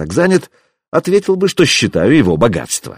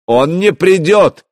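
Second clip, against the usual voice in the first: high, raised denial; fierce shout; 8 semitones higher, 4 dB louder, slower than usual. A narrator's reading. Prince denying that help will come, fierce shout. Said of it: "крикнул Вадимир. Слишком быстро и слишком яростно".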